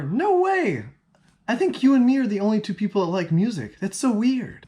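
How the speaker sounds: hammed-up gushing; mock excitement. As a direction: tease them with fake enthusiasm. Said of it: "tease them and say".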